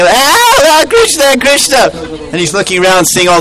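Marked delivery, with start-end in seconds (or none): 0.0-1.9 very high wailing calls; mock distress cries. Boys tied up in trees calling Krishna, very high mock cries. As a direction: cry out for help, hammed up.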